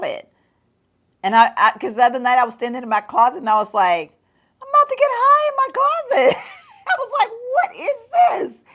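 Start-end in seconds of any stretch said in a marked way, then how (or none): 4.6-8.6 mock high voice. Mock quote of herself in closet.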